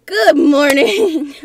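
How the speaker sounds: loud, slow, wailing greeting; drawn-out frightened wail of good morning; scared wail. Real, not acted. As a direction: cry it out in fright. Frightened wake-up in horror game.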